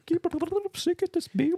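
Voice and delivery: very high squeaky voice; squeaky creature. Pretend. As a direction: squeak it like a tiny sea creature.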